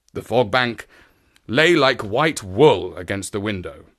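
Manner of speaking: ordinary delivery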